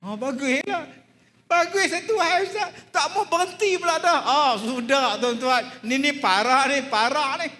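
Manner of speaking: high, loud preacher's outburst; fiery preaching. Acted. Preacher rousing the congregation, loud and high. Preacher at full force, excited opening.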